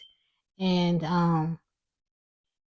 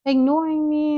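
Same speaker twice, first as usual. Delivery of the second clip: apologetic woman; 9 semitones higher, 4 dB louder than usual. Retold or imitated. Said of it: "She's like".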